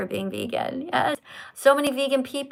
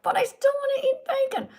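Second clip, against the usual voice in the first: high, whiny excuse voice; whiny mock voice; 14 semitones higher than usual. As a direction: whine it like a kid. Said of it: "We're like".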